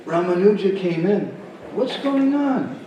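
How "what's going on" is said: high, drawn-out query; startled question. Acted. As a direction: ask it high and slow, taken aback by the noise. Guru reacts to the noise.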